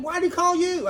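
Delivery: loud, high incredulous question; imitated bafflement. Customer questions shop owner about famous caller, loudly baffled. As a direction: demand it as the stunned customer.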